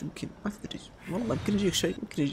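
hushed ominous hint; spooky whisper. Pretend. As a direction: whisper it like a creepy warning.

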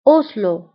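أوسلو as French os-lo with open o; French-style أوسلو, short with falling end; French pronunciation. Shown as a demonstration. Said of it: "بالفرنسية أيضًا".

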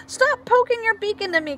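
high, mild mock shout; a touch of an angry shout; kinkajou shouts. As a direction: complain at the pushy toucan. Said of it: "Kinkajou shouts at Toucan".

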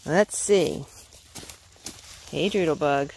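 usual speaking voice

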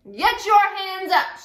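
high, loud, slow order; commanding. A narrator's reading. Little Jemima ordering the bandits, loud and slow.

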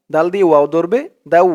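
ordinary delivery

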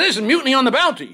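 high, loud hammed-up indignation; mock outrage. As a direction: rant about mutiny like a wronged captain. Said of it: "I said".

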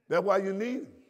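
ordinary talk

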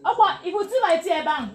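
loud, high call across the room. Real, not acted. Teacher on a livestream shouting to Opa about her tea, loud call. Calling out to someone off-mic.

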